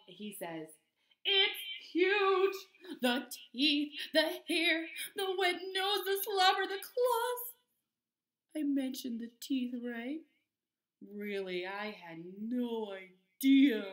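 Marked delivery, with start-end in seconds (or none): 1.2-7.5 high, loud panicked list; scared pigeon. Acted. Pigeon listing the puppy's horrors, scared. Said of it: "He looks scared". 11.2-13.8 slow, drawn-out admission; overwhelmed pigeon. Acted. Pigeon shocked by what puppies bring, overwhelmed. Pigeon admits his mistake.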